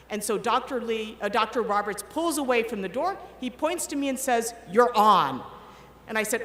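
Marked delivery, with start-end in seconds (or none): 4.7-5.4 loud, brisk send-off; loud call. Retold or imitated. Colleague pointing her in: a loud call. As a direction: announce her turn, loud. Boss sends her in loudly.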